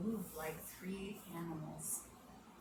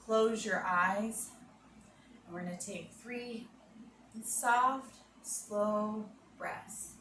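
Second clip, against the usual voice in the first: guided relaxation; 3 dB quieter than usual. Acted. Yoga teacher guiding children, calm and soft. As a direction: lull the kids into calm breathing.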